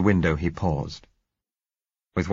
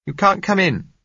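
high, loud woman's call; calling through door; 14 semitones higher, 6 dB louder than usual. Acted. Woman calls through closed door.

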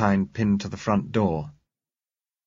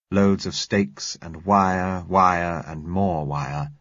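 slow, each wire stretched out; weary emphasis; much slower than usual. Acted. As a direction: dwell on wire, wire, and more wire. Weary list of endless wire.

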